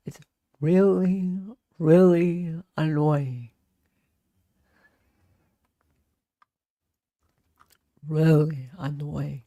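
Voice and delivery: slow, lightly vocal-fried courtroom voice, only a little off her own; Ashley Jennings impression. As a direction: drawl it out slowly with a hint of fry like Ashley Jennings. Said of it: "I've tried to do Ashley Jennings' voice".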